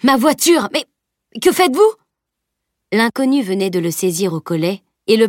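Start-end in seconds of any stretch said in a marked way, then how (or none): 0.0-1.9 high, loud panicked protest; alarmed cry. Acted. Driver seized by the collar, panicked high cry.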